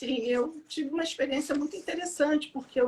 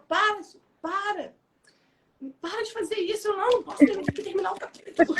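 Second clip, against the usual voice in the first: imitating fed-up family, higher pitch; mock pleading to stop; reclamação imitada; 7 semitones higher than usual. Família reclamando, fala imitada. (reclamação em tom agudo, imitando)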